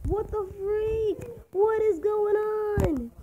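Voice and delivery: high, long-drawn wail of disbelief; exasperated disbelief. Real, not acted. Player swamped by monsters, exasperated. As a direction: stretch each word out high and slow.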